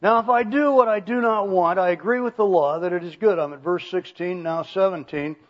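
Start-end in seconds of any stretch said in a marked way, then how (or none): 0.0-3.4 louder, raised scripture reading; emphatic reading. Shown as a demonstration. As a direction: read the verse out with firm emphasis. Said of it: "I'm gonna emphasize some of those emphatics".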